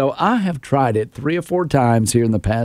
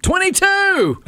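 high, drawn-out call; sudden sleep shout; slower than usual. Retold or imitated. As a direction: holler it out like a countdown announcer. Retells shouting out number in sleep.

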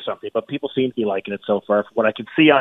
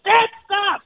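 very high-pitched exasperated cry; exasperated plea; 4 dB louder than usual. Retold or imitated. Son confronting his dad about planted rumors, exasperated. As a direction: plead with an embarrassing parent to quit. Retold confrontation with meddling father.

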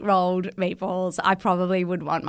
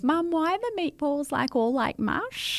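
put-on kid's voice; 8 semitones higher, 5 dB quieter than usual. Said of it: "one of the kids goes".

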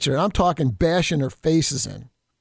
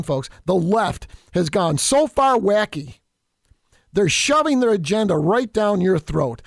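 usual speaking voice

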